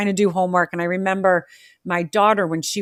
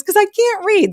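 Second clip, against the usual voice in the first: put-on high, louder child's wail; the small daughter blurting it out, much higher; crying little girl; 6 dB louder than usual. Mother voicing her daughter explaining why she cries, high and loud child voice. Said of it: "And she goes".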